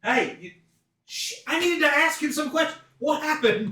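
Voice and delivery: high, loud in-character protest; indignant outcry. Acted. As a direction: call out in exasperated disbelief. Character confronts killer over bartender.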